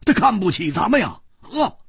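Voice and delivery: high, agitated outburst; stamping impatience. A narrator's reading. Hu Tiehua feels left out of a fight, impatiently indignant. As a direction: fire it out in indignant bursts. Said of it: "胡铁花跺着脚说".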